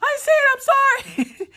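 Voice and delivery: very high, slow mock wail; scared kids. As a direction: squeal it like a terrified child. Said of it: "they're just like".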